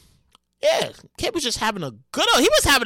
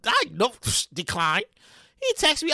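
usual speaking voice

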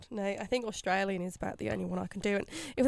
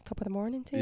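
mock Irish accent; a quick clichéd Irish accent; stock Irish accent; 5 dB quieter, faster than usual. Imitating people asked to do accents, stock Irish accent. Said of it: "if they can do an Irish accent".

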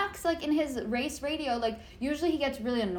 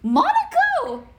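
much higher, louder, stretched-out shriek; excited shout; 8 dB louder, much slower than usual. Real, not acted. Host spotting a listener city, slow excited squeal. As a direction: squeal the place name with real delight.

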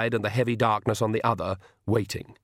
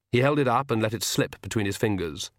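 usual speaking voice

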